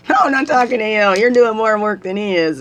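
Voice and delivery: teasing call. Retold or imitated. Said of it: "he said".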